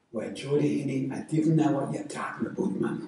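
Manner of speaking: a light Geordie accent, imitating Uncle Jim. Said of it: "to speak Geordie".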